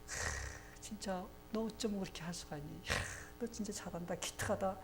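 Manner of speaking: hushed, impressed exclamation; admiring wonder. Retold or imitated. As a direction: marvel quietly, as if proud of a child. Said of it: "막 이러면서 감탄을 하시는".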